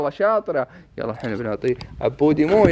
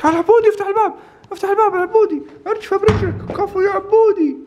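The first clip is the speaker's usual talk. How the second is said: excited; 13 semitones higher than usual. Pretend. Urging dog, excited high voice.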